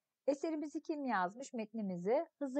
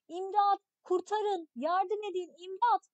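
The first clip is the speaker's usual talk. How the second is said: crying for help, higher and louder; shouting for help; 7 semitones higher, 6 dB louder, slower than usual. Acted. Hasan calling for help for the injured boy, full shout. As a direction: yell for help with all your strength. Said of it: "Bütün gücüyle bağırmaya başlıyor".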